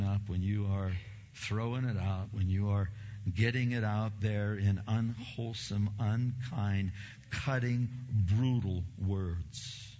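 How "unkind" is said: ordinary talk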